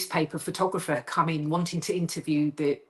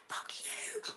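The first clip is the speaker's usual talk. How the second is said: hissed under the breath; a sharp whisper at her friend; whispered curse; 19 dB quieter than usual. Retold or imitated. Cursing the laughing author, whispered.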